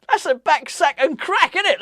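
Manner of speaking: laughing. Real, not acted. Joking about waxing everything off.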